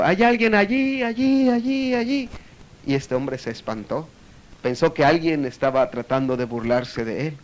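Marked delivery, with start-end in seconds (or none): 0.0-2.3 high echo fading away; cave echo. Retold or imitated. Cave answering the man, imitated fading echo. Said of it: "la cueva contestó el eco".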